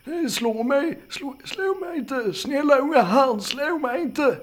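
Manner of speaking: high-pitched panicked pleading; frightened old man. Acted. Startled old man begging a young camper, frightened. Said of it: "utbrast han".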